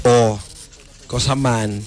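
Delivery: usual speaking voice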